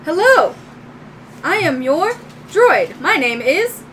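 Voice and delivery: high robot greeting; little droid. Acted. Little droid greeting its new owner, high robotic voice. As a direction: introduce yourself as a robot, name left open. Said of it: "your little droid walks up and goes".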